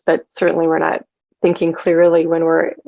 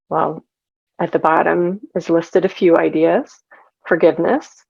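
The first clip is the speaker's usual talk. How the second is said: normal talking voice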